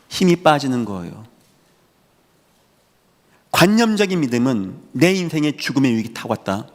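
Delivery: ordinary delivery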